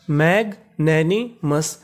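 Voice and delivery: very slow, stretched मैग्नैनिमस with a falling end; very slow model reading; very slow demo word. Shown as a demonstration. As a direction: linger on every syllable of मैग्नैनिमस. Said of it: "तो पूरी meaning".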